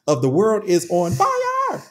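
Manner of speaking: slow, grand announcer delivery; mock epic outro; host sign-off. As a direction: wrap it up like a show host. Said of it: "show sign-off".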